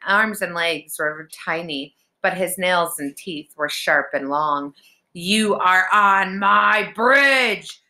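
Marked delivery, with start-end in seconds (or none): 5.1-7.8 slow, loud monster bellow; angry troll, higher and louder, drawn out; shouting troll. A narrator's reading. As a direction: roar it out word by word. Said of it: "the troll shouted".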